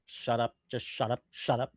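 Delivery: low, soft, annoyed grumble; quiet fed-up shut up; muttered shut up. Real, not acted. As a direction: mutter it under your breath at the screen. Annoyed at clip, repeating.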